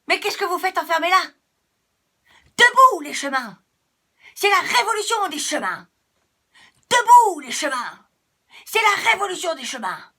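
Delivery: a little path shouting a rebellion; rallying cry. Acted. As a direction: rouse the crowd to rise up. Little path calls others to revolt.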